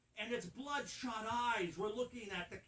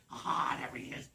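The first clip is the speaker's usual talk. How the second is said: gorilla voice; 4 semitones lower, 9 dB louder than usual. Acted. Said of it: "it looks around and it goes".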